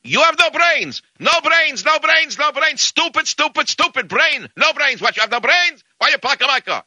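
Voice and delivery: very high-pitched hammed-up Russian rant; mock screaming Russian. Crazed Russian screaming at John over a parked car, mock screaming. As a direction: imitate an enraged Russian yelling in a high voice. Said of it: "screaming and yelling".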